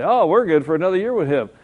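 a hammed-up cheery pastor; imitating Pastor Anker. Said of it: "Pastor Anker would say".

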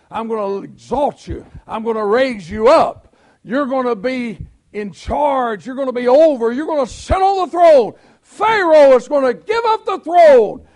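preacher thundering God's words to Joseph; building proclamation. Acted. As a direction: proclaim God's promises, climbing. Voicing God's promise in sermon.

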